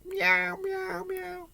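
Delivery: high, thin drawn-out meows; imitation cat cry; cat meowing. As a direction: mew softly like a cat. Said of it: "the cat started meowing".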